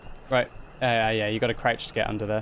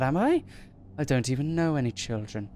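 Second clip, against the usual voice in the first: normal talking voice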